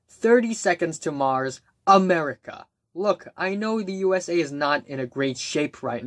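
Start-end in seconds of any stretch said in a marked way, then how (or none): none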